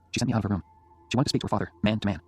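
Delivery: ordinary delivery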